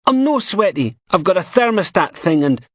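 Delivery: very high, squeaky, strained; hoarse and squeaky. A narrator's reading. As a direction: choke out words, squeaky and hoarse. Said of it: "His voice comes out all hoarse and squeaky".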